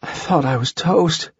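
breathless relief after danger; out of breath. A narrator's reading. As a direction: gasp it out, winded. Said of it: "Holly lay there gasping for breath".

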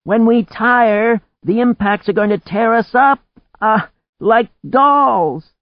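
loud high-pitched yell, words stretched out; shouting. A narrator's reading. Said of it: "she shouted".

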